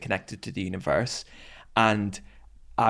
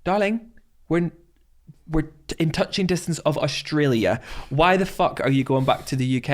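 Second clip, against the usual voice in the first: higher, campy friend exclaiming; mock-outraged friend, higher; friend's voice; 6 semitones higher than usual. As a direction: play the flamboyant friend scolding. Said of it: "And she went".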